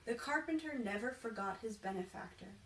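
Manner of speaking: ordinary delivery, nothing marked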